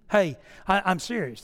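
usual speaking voice

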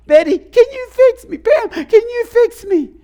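very high, loud falsetto wail; exaggerated helpless cry, stretched out and high-pitched; mock pleading. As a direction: beg in a hammed-up squeal. Acted begging for help again.

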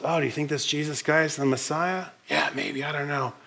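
hushed, soft gossiping murmur; hushed tones. Retold or imitated. Crowd whispering about Jesus in hushed tones. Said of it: "conversations in hushed tones".

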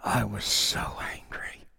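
slow, low whisper; hushed intensity. Real, not acted. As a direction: drop to a slow whisper, weighing each word. Preacher recalls his anger at the assault.

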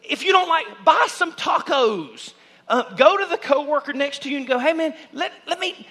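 high, loud, driving sermon delivery; preaching at full force. Real, not acted. Pastor urging his church to go serve others, preaching hard. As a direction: push the congregation to act, full volume.

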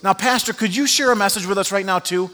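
a hint of an eager translator's ask. Translator springs a request on the pastor, surprised.

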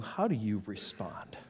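soft, voiceless whisper; whispered question. Real, not acted. Pastor asking the congregation how they respond, in a whisper. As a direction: draw them in with a hush.

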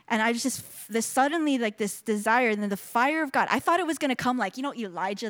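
usual speaking voice

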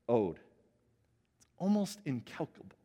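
normal talking voice

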